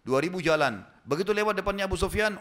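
ordinary delivery

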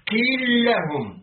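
قيل لهم run together as qīl-lahum; merged lams. Shown as a demonstration. Reader demonstrates قيل لهم with the two lams merged. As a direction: hold one doubled l across قيل لهم, slowly. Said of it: "بإدغام اللام في اللام،".

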